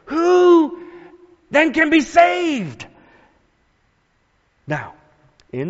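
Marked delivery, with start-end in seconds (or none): 0.0-2.9 high, stunned cry of dismay; amazed cry. Acted. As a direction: voice the disciples' alarm, pitched up high. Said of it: "the disciples are even more amazed and said to each other".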